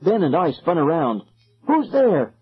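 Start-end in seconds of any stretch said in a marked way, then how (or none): 1.7-2.3 high, frightened call; frightened attempted shout. A narrator's reading. Calling to unseen figures in fog, frightened. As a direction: try to shout through the fear. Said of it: "I tried to shout".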